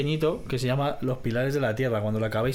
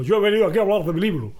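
quick, higher quoted complaint; mock-insistent Spanish interviewee; indignant interview guest; 4 semitones higher, 4 dB louder, faster than usual. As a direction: imitate an affronted guest on a show. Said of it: "Es un tipo que fue a una entrevista".

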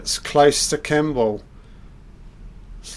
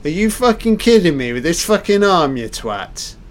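much higher and louder, hammed-up rage; mock furious Kimball; 6 semitones higher, 5 dB louder than usual. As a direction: rant like a wronged man. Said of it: "Kimball's like".

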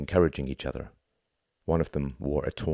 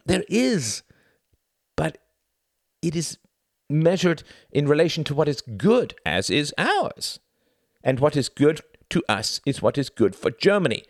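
higher, halting then emphatic argument; insistent debater; 11 semitones higher, 5 dB louder than usual. Acted. Debater answers on British culture, insistent. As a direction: argue it out phrase by phrase.

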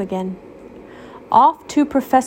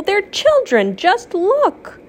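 outraged Waffle; 11 semitones higher, slower than usual. Acted. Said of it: "screamed Waffle".

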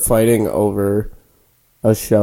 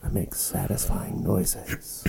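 very soft, slow whisper; hushed demo of whispering; ASMR whisper; 3 semitones lower, 12 dB quieter, slower than usual. Shown as a demonstration. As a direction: keep whispering, drawn out. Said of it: "like, whisper".

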